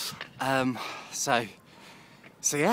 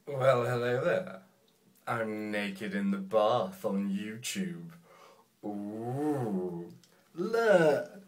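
half-joking sultry greeting from the bath; mock seductive; 2 semitones lower, much slower than usual. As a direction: play it sultry as a joke.

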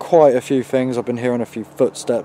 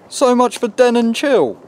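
exasperated; 12 semitones higher, 6 dB louder than usual. Real, not acted. Exasperated after spiders.

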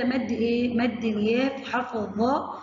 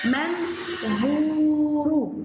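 منظور drawn out slowly, long u held; slow sing-song منظور; slow stretched word; 3 semitones higher, much slower than usual. Shown as a demonstration. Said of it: "زي كلمة إيه يا ولاد؟".

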